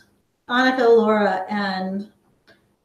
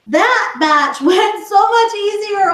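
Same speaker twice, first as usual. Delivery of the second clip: excited; 9 semitones higher, 4 dB louder than usual. Real, not acted. Delighted the batch went easier.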